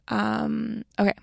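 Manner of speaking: usual speaking voice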